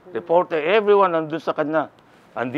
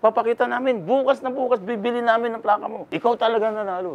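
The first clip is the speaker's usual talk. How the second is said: pitched up, eager insisting; excited insisting; 8 semitones higher than usual. Retold or imitated. Neighbors insisting to him that he won, eager and pitched up.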